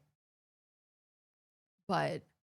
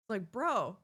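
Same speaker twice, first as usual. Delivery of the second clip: usual speaking voice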